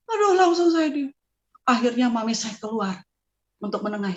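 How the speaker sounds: ordinary delivery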